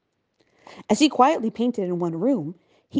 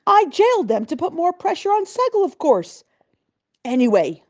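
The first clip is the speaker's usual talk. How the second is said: loud, higher furious governor; ranting governor; 9 semitones higher, 4 dB louder than usual. A narrator's reading. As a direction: rant about the stingy lender. Governor explains.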